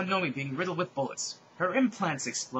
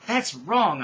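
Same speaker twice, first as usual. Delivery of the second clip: loud, raised mock scream; acted yell, pitched up; screamed protest; 5 semitones higher, 8 dB louder than usual. A narrator's reading. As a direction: cry out in storybook outrage. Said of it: "I screamed".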